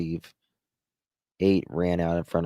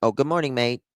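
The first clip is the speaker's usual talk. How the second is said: mock Australian; 4 semitones higher, faster than usual. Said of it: "How do you say good morning in Australia".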